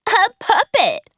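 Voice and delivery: high, loud taunting cry; jeering shout. A narrator's reading. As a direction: sneer it out at the new puppet. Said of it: "shouted the school bullies".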